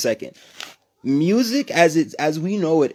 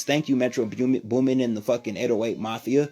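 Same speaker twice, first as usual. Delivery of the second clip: ordinary delivery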